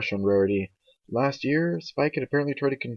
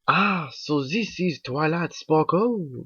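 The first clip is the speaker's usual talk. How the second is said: put-on slow, higher European accent; 6 semitones higher, much slower than usual. A narrator's reading. Spa owner meeting Twilight, somewhat European accent. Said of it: "said in a somewhat European accent".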